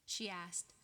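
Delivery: plain reading voice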